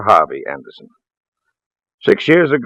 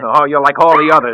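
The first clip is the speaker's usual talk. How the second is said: unhinged accusation; 6 semitones higher, 7 dB louder than usual. Acted. Man grows unhinged.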